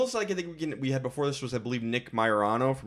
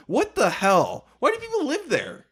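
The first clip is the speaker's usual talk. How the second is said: high, loud what-the-hell disbelief; baffled and outraged; incredulous; 11 semitones higher, 4 dB louder than usual. Real, not acted. Disbelief at Phoenix.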